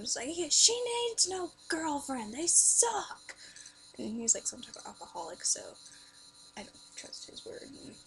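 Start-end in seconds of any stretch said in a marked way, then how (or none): 0.3-3.3 loud, high, drawn-out holler; hammed-up heckling uncle voice; imitated uncle. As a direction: holler it from across the room. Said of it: "I could hear Uncle Nathan in the background".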